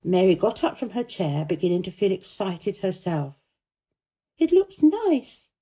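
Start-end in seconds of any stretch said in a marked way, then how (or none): 4.4-5.5 put-on high, slow girl's voice; a lifted, careful child; Mary's voice. A narrator's reading. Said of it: "she said".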